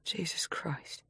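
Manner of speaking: breathless shocked whisper. A narrator's reading. Christy whispering in horror after the call. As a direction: barely breathe the words. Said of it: "Christy murmured".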